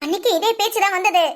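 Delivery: ordinary talk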